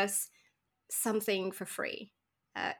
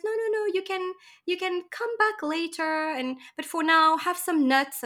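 higher, brighter-voiced seller insisting kindly; friendly Sicilian vendor; 8 semitones higher, 4 dB louder than usual. Juice vendor insisting tourists take free nuts, high and eager. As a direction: coax them to take the nuts, pitched up. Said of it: "and he says".

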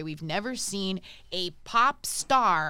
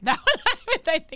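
laughing; 9 semitones higher, 8 dB louder than usual. Real, not acted. Laughs at own remark.

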